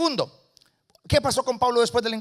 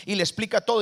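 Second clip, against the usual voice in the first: ordinary talk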